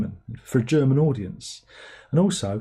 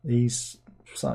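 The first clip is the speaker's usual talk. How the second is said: ordinary delivery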